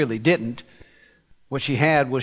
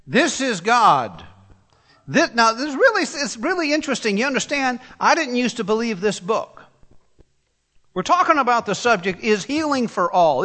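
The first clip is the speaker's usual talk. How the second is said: raised, earnest preaching; fervent sermon, higher than his story voice; 6 semitones higher than usual. Real, not acted. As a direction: press the point to the listeners.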